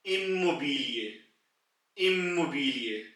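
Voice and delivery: इमोबिलिए said slowly and carefully, long ई sound at the start; German word demo. Shown as a demonstration. Teacher showing learners the German word, slow and careful. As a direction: spell out इमोबिलिए syllable by syllable. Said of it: "इसको German में apply करेंगे".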